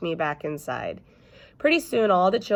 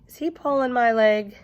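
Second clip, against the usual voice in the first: exasperated aside; 7 semitones higher, 5 dB louder than usual. Acted. Elf Ellen to teacher.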